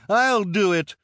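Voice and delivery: loud, very high eager call; high-pitched henchwoman's cry. A narrator's reading. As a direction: pipe up loudly to volunteer.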